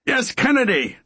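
sharp high indignant cry; indignant outburst. Acted. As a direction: fling the name out, scornful.

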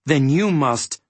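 mild gasp of alarm; breaking off in dawning shock; gasped realization. A narrator's reading. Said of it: "gasped Milo".

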